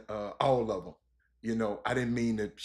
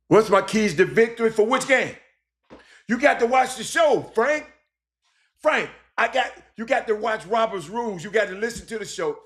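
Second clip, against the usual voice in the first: loud, high-pitched exasperation; exasperated scolding; 8 semitones higher, 5 dB louder than usual. Real, not acted. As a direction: raise your voice, chiding the listener. Exasperated answer to a listener's question.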